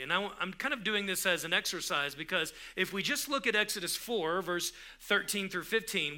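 ordinary delivery

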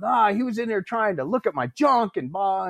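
high-pitched mock outrage; pissed-off complaint. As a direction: imitate an indignant instructor griping. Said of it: "he was pissed".

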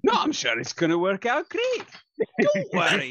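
high-pitched, hammed-up assurance; exaggerated reassurance. Repeating it on request to prove certainty, overdone reassurance. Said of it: "Say it one more time exactly that way".

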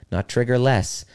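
ordinary delivery

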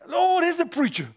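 high mocking call of the workers; a teasing jab at the preacher; mock taunt. Workers mocking the preacher, high teasing call. Men mocking him at lunch.

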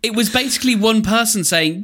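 ordinary delivery

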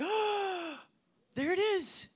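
high thrilled exclamation; light up at the find; delighted discovery. Pretend. Reader discovering truth in the Bible, delighted. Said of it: "You're like".

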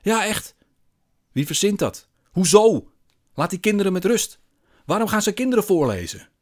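loud, very high protest; outraged. A narrator's reading. Man outraged about readings to children, shouting. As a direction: shout each question in outrage.

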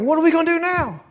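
high-pitched, mock-helpless question; helpless dismay. Disciples at a loss after the cross, helpless.

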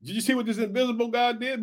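usual speaking voice

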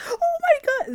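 hammed-up girly squeal; squealing coworker. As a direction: shriek like a thrilled girlfriend. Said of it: "He's like".

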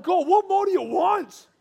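high, loud and slow, near breaking; anguished plea. Retold or imitated. Pastor pleading with God on the beach, high strained anguish. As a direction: stretch each word out in strained desperation. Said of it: "I'm like".

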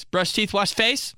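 high-pitched mock aunt reminder; nagging aunt. Imitating aunt's nightly bedtime orders, high nagging. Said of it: "the only thing my aunt's ever said".

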